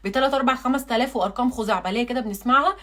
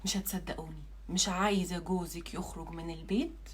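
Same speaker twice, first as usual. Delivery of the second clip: hushed confiding; 5 semitones lower, 11 dB quieter than usual. Acted. Conspiratorial tip to wives.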